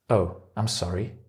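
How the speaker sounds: soft, sheepish apology; apologetic. Acted. Teacher acting the reply to a sarcastic remark, apologetic. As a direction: drop the volume, sorry to have disappointed. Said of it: "or it's really bad".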